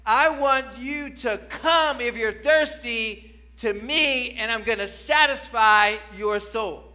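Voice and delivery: high, loud, slow proclamation; preaching the Lord's words. Retold or imitated. Preacher voicing the Lord calling the thirsty, loud and slow. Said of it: "He says".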